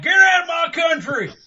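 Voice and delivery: very high, loud, hostile shout; hammed-up angry yell. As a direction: holler like a cranky old bigot. Voicing an old racist.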